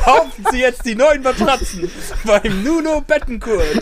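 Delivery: bright, high mock commercial voice; chipper 1950s ad. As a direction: sell it like a cheesy old TV ad. Mock mattress ad pun.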